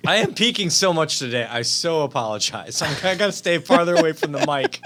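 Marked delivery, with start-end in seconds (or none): none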